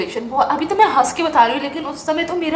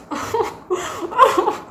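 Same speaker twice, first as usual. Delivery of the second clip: high giggle fit; 7 semitones higher, 3 dB quieter than usual. Real, not acted. Laughs at own absurd story.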